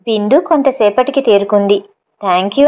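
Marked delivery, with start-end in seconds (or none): none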